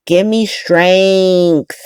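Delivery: begging for patience, very slow; slow weary plea. Real, not acted. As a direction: Give me strength, stretched out very slowly.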